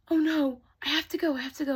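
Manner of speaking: high, loud panic; panicked. Acted. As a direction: rush it out in alarm. Panic at midnight.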